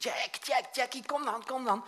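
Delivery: high, loud call across a street; calling the dog. Retold or imitated. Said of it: "En ik riep". (luid de hond roepen)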